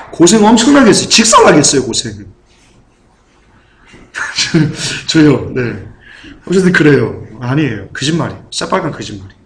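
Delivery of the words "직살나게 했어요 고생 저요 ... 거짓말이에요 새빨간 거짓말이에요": ordinary delivery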